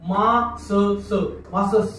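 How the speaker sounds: मा स स sounded separately, then joined as मासस; mock misreading मासस with two separate s sounds; wrong double s. Shown as a demonstration. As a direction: sound each "s" of मासस on its own. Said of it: "असा उच्चार होईल का रे?".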